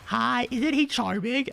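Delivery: put-on very high, slow, sweet old-lady voice; doting old lady. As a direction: croon it up high like a fond grandma. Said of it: "and like".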